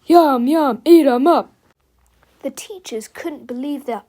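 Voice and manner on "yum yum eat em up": loud, repetitive monster chant; bellowing. A narrator's reading.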